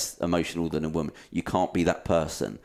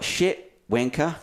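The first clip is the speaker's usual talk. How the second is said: put-on Kiwi accent, higher pitched; New Zealand accent; 7 semitones higher, much slower than usual. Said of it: "He said that with a, like, a New Zealand accent then".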